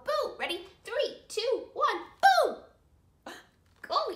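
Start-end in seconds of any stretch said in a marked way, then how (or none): 0.0-0.4 high demo "boo" to startle; teaching kids a surprise boo; playful boo. Shown as a demonstration. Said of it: "pop up and go". 2.2-2.6 loud, high surprise "boo"; big pretend-scary boo; playful boo. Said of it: "pop up and go".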